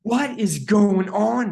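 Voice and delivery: high, loud, slow bewilderment; loud, incredulous mock outburst; acted disbelief. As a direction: stretch it out in amused astonishment. Reenacts disbelief at mother's denial.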